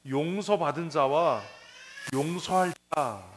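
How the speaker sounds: 용서받은 자와, 용서할 자 spaced out slowly and loud; emphatic and slow. Shown as a demonstration. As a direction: weigh each word of 용서받은 자와 용서할 자. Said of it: "핵심이 뭐예요". (용서받은 자와 용서할 자를 또박또박 천천히 크게)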